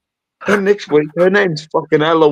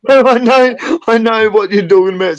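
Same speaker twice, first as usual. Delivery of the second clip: laughing reply; 7 semitones higher, 6 dB louder than usual. Real, not acted. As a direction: answer while laughing.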